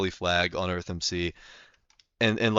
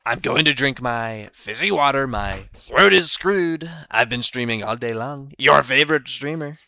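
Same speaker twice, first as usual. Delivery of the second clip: Donald Trump; 5 dB louder than usual. Acted. Streamer rambling in character about his drink, loud politician impression. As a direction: stretch and punch key words like a rally speaker. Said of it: "I have to, like, get into character".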